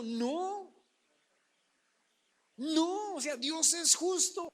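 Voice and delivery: no, no, push it up emphatically; firm protest. Real, not acted. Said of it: "yo digo".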